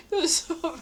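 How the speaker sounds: ordinary talk, nothing marked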